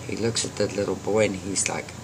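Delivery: ordinary delivery, nothing marked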